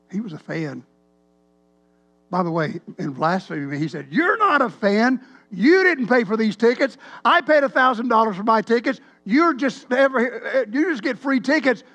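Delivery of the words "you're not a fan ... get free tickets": loud, high, accusing tirade; imitating a furious rant at full volume; angry screaming fan. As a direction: tear into him like an outraged fan. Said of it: "I mean, he screamed".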